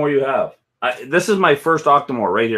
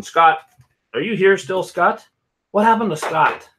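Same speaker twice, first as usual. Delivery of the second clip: raised-pitch mimicry of everyone asking; imitating pestering fans; 7 semitones higher, 4 dB louder than usual. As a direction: mimic the fans' questions, pitched up. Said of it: "everybody keeps asking".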